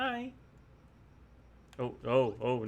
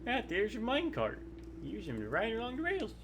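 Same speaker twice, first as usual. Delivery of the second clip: put-on higher, folksy old miner voice; old prospector; 11 semitones higher than usual. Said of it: "Why does it sound like an old prospector?".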